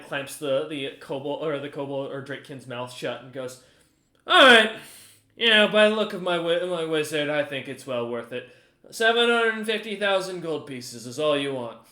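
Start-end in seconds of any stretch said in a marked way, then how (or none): 4.3-11.8 lightly voiced Goose, agreeable buyer. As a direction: open loud, then settle into dealmaking. Plays buyer Goose accepting deal.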